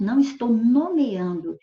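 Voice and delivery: normal talking voice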